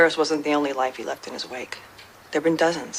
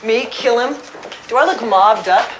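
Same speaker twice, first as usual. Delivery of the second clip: higher, rising incredulous question; scoffing disbelief; 5 semitones higher, 5 dB louder than usual. Acted. Retired producer to detectives suspecting her of murder, incredulous. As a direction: throw the accusation back, louder at the end.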